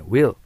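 "Wheel" said with no H sound, just a W; silent H. Shown as a demonstration. Teacher showing learners the modern pronunciation, "Wheel" with silent H. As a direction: say "Wheel" starting on W, the H dropped. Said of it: "今の発音はほとんどHを発音しません".

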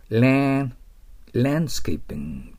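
ランドスケーピング as "laaanscaping", d dropped; English pronunciation. Shown as a demonstration. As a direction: stretch the æ in ラン and swallow the d. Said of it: "と言わないと".

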